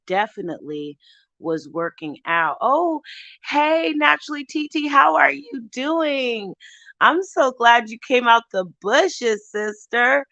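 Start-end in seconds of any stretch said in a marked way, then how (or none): 2.6-10.3 high, loud, delighted hello to a friend; light up and call her name, drawing out how are you; excited greeting. Real, not acted. Vlogger greeting a returning subscriber in chat, excited.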